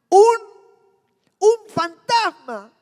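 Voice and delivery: frightened disciples crying out to each other, a terrified scream. Acted. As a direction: scream like you've seen a ghost. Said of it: "llenos de miedo gritaron".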